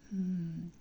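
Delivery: ordinary talk, nothing marked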